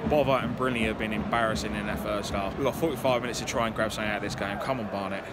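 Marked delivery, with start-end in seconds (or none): none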